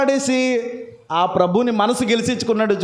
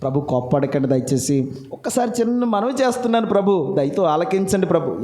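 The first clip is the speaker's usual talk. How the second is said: humble plea; 4 semitones lower than usual. Retold or imitated. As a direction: ask gently, almost bowing. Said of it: "ఎంత చక్కగా ఈ ప్రార్థన".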